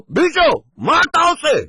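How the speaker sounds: loud high shout from the window; husband yells high and loud from the window; husband yelling. Acted. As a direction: yell it across the yard. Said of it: "צועק לו".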